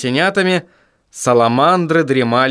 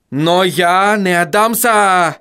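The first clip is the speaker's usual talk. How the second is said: shout; 6 semitones higher, slower than usual. A narrator's reading. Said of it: "закричал он".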